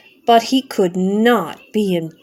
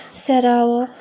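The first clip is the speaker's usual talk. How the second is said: ordinary delivery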